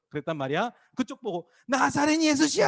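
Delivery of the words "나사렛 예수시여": high, sustained calling out; acted beggar yelling for attention; insistent shouting. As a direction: keep calling out, pitched way up. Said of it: "하도 소리를 지르니까".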